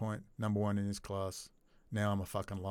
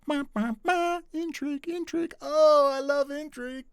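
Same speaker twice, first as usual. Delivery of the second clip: much higher, playful singsong; gleeful singsong; 8 dB louder than usual. Real, not acted. Gleeful aside about intrigue.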